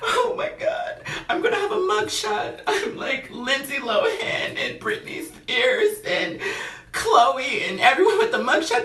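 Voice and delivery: high, teary laughing panic; half sob, half laugh at the disaster; laugh-crying. Retold or imitated. Picturing her own celebrity mugshot, laughing through tears.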